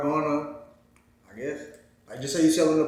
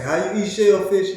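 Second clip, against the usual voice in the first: higher, louder quoted question; imitating people asking; 5 semitones higher, 6 dB louder than usual. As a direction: mimic a nosy crowd asking. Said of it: "Everybody say".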